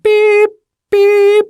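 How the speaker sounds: high, held electronic beep; imitated alarm beep. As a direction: sing out a steady high-pitched beep.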